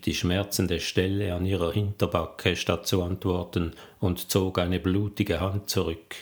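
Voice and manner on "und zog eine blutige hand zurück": ordinary delivery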